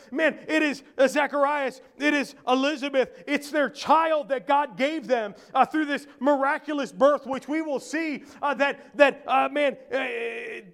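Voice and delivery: urgent, high-pitched pulpit delivery; forceful preaching. Real, not acted. Preacher explaining the Christmas story to the congregation, forceful.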